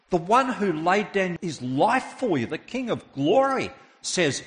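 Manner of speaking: high, loud preacher at full force; impassioned preaching. Real, not acted. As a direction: drive each phrase home like a commanding proclamation.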